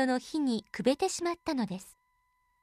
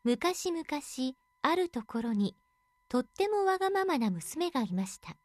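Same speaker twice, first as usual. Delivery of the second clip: plain reading voice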